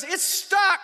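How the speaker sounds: high, loud imitated holler; frantic reply. [name] explaining about the hose, frantic high holler. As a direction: shout back the bad news. Helper's frantic answer, acted.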